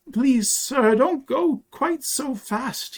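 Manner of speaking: put-on high-pitched child pleading; panting little boy. A narrator's reading. Small boy begs the striding stranger to slow down, panting. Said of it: "he panted".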